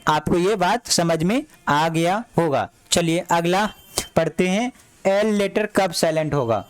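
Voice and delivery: ordinary talk, nothing marked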